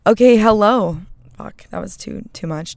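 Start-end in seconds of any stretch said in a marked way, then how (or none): 0.1-1.0 a loud, overexcited hello; too excited. Real, not acted. Host greeting podcast listeners, far too loud. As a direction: blast the greeting way too hard. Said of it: "Too excited".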